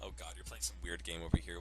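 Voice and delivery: usual speaking voice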